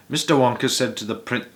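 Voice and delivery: usual speaking voice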